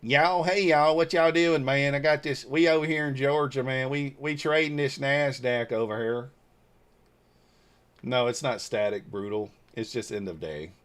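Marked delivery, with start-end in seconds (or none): 0.0-6.3 exaggerated good-ol'-boy southern accent. Shown as a demonstration. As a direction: lay the Georgia southern accent on thick. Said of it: "Where's the southern accent?".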